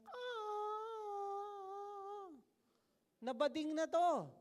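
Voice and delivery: mock the devil falling forever in a shrieking high voice; Satan screaming. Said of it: "yung sigaw ni Satanas".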